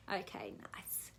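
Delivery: soft whispered approval; hushed whisper to a relaxing listener. Real, not acted. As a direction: breathe the words, no voice. Whispered during ear massage.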